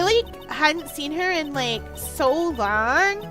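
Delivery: put-on drawling Valley Girl testimony; Valley Girl accent. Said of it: "the return of the Valley Girl accent".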